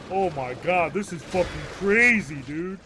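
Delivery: high, loud, stretched-out awe; mock amazement. Kid buys into the fake emergency, mock amazement. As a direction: gush with hammed-up disbelief. Imagined kid awed by the attention.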